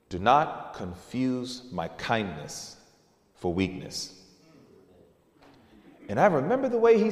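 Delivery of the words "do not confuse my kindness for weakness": low, slow, hushed threat; quiet stern warning. Retold or imitated. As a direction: say it slowly and softly, deadly serious. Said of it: "And he said".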